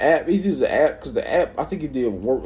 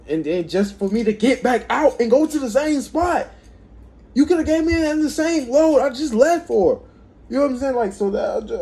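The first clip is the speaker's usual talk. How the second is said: real exasperated rant, pitched up high; rising exasperation; 11 semitones higher than usual. Real, not acted. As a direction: voice climbing higher in frustration. Building frustrated rant.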